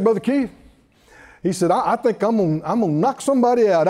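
normal talking voice

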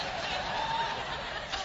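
squealing burst of laughter; high shrieking laugh. Real, not acted. Laugh after the bit.

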